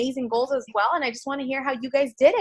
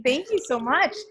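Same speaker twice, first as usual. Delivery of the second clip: ordinary talk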